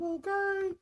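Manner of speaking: soft, high squeal of OKAY; drawn little high-voiced okay; playful okay. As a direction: pitch it way up, cute and teasing. Playful check after soft remark.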